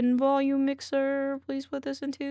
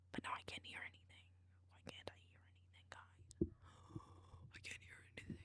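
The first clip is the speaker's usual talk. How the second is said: whispering; 26 dB quieter, faster than usual. Real, not acted. Confused streamer whispering to chat.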